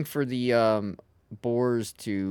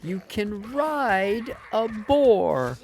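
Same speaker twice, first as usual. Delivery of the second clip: announcer voice; 8 semitones higher, slower than usual. Pretend. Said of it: "I repeat".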